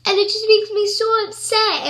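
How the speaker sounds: real upset, voice pushed high; trying not to cry. Real, not acted. Telling viewers about blamed animals, close to tears. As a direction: let distress lift the voice. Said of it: "I'm really trying not to cry".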